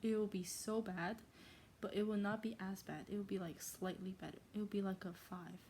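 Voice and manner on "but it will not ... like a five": ordinary delivery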